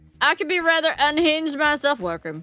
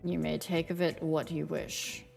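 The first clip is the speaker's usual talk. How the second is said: low, cool Reaper voice, lower pitch; Grim Reaper voice; 5 semitones lower, 8 dB quieter than usual. Acted. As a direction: aloof death god, calm and low. Death's game lines voiced.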